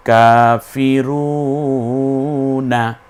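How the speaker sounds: very slow chanted word; Quran recitation. Shown as a demonstration. Teacher recites the word slowly for students. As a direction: draw out each vowel melodically.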